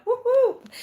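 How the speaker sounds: high, loud double whoop; playful whoop. Real, not acted. Livestreamer cheering a viewer who feels younger, playful whooping.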